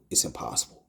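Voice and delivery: breathy whisper, low; whispered emphasis. Real, not acted. Recalling drowning, he whispers the point. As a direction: hush it, as if in awe.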